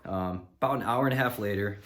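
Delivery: ordinary talk, nothing marked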